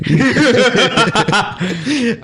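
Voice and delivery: high burst of laughter; loud laugh. Real, not acted. Laughs at ruined bit.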